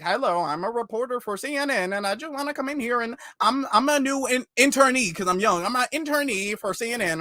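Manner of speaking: hammed-up rookie reporter in disguise; pretend young reporter. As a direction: play a goofy newbie intern introducing himself. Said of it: "be dressing like Clark Kent".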